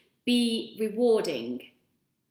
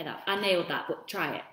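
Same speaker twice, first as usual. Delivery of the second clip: ordinary talk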